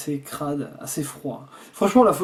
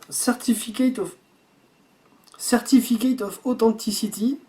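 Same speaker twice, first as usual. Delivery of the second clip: English read with a French accent, pitched higher; 5 semitones higher than usual. Real, not acted. Reading the certificate to viewers, French-accented English. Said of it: "désolé pour mon accent anglais".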